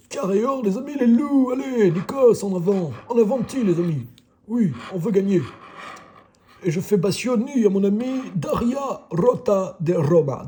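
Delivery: high, loud, showy TV host voice; Nikos Aliagas impression. Vlogger hosting his scratch tickets as the TV host, loud and high. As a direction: ham up the big TV presenter greeting the fans. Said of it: "Je m'appelle Nikos Aliagas".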